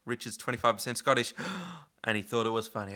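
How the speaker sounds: ordinary talk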